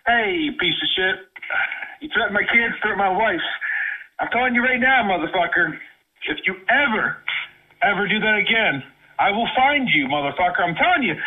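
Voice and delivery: fury building in bursts; real angry threat. Real, not acted. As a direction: warn them furiously, voice starting to climb. Furious threat over family, by phone.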